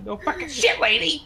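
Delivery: indignant outburst. Acted. Indignant in character.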